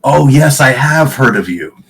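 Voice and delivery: high, loud fawning; mock flattery. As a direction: gush with fake awe. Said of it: "tilts his head forward ... and goes".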